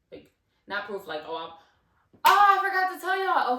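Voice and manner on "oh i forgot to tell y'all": much higher and louder, a burst of recall; sudden remembering. Real, not acted. Telling viewers a forgotten detail, excited outburst. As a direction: it just hit her, blurt it out.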